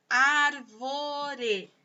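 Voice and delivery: "árvore" slowly stretched into ár, vo, re; syllable by syllable. Shown as a demonstration. Teacher showing three sounds to pupils, "árvore" syllable by syllable. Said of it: "tem três".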